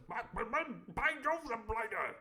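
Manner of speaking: high, stammering posh exclamation; Squire's catchphrase. Acted. The Squire blustering his catchphrase, high and stammering. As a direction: sputter it like a pompous toff. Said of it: "Like that".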